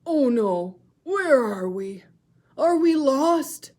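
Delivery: loud, slow, worried seal pup; a lost little seal starting to panic; worried Baby Seal. Shown as a demonstration. Teacher voicing a frightened Baby Seal. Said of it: "show you how to use voices".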